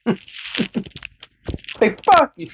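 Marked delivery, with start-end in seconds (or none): 1.8-2.5 much higher, squeaky curse; mock Linda insult. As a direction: fling it like a petty brat. Voicing curse.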